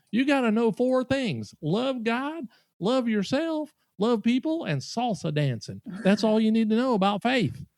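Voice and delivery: high-pitched Southern proclaiming; Southern accent. Retold or imitated. Diner repeating his four faith rules, in a Southern accent. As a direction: list them like an earnest Southern diner. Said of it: "in this Southern accent".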